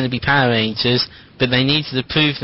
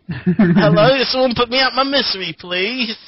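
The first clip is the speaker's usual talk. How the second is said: higher, pained put-on monster voice; burnt lizard monster; 8 semitones higher, 5 dB louder than usual. Burnt monster begging the rangers, hammed-up whiny creature. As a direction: whine like a half-cooked lizard. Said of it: "I'm the lizard monster".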